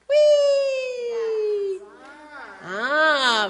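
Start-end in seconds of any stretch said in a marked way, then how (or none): 0.1-1.8 high long joyful whee; gleeful whee. Acted. Reading to kids, a high gleeful whee. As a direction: swing through the air with joy. Boy swings on vine.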